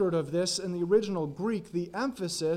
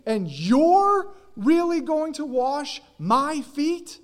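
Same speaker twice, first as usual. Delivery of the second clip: higher, slow, disbelieving plea; incredulous Peter; 8 semitones higher, 6 dB louder, slower than usual. Retold or imitated. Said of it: "he's voicing it like".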